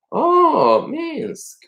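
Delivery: high-pitched acted "oh" of recognition; light up at a familiar name, voice jumping high; storyteller's high, surprised host; delighted recognition. Host recognizes guest's hometown.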